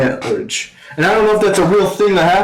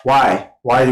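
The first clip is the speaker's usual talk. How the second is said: usual speaking voice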